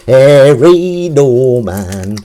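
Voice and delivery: slow mock-grand announcement of the name; drawn-out name. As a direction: stretch the name out like a grand introduction. Repeating the name for effect.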